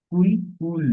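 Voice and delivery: কুল clipped short, then কুল with a stretched u; short then long. Shown as a demonstration. Teacher drilling the vowel difference, short then long. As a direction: contrast a brief u and a held u in কুল. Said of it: "এভাবে".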